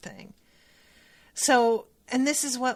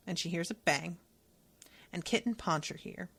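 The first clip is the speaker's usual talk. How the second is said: normal talking voice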